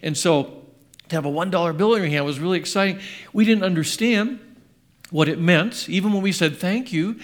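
usual speaking voice

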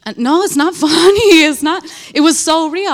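loud, high, laughing it off; a loud laughing "it's not funny"; laughing protest. Real, not acted. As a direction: insist it's not funny while cracking up. Laughs at her own story.

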